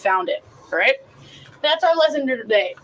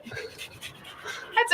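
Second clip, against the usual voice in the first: That's it whispered, higher and softer; sly whisper; 11 semitones higher, 11 dB quieter than usual. Acted. Teasing cohost about wanting the tip, sly whisper.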